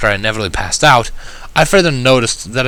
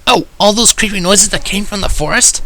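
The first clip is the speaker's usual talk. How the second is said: Rainbow Dash; 11 semitones higher than usual. A narrator's reading. Rainbow Dash voiced in story.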